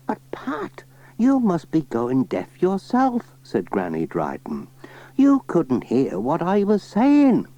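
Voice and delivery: put-on high old lady voice; chatty old granny, pitched high. Granny Dryden asking Pat about the spade, high old voice.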